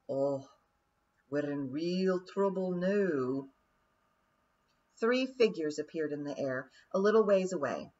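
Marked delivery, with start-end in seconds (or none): 0.1-3.5 lightly voiced, slow worried pixie; slow Scots worry; worried Daft Woolly. A narrator's reading. Daft Woolly in the crowd, lightly voiced slow worry. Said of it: "a voice ... like that of Daft Woolly".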